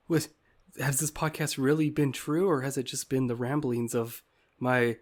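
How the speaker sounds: normal talking voice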